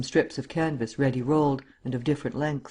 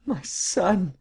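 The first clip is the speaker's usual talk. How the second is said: high, breathy wail of a mother; acted despair, voice pitched up; anguished cry; 5 semitones higher than usual. A narrator's reading. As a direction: cry out in grief. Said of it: "she cried".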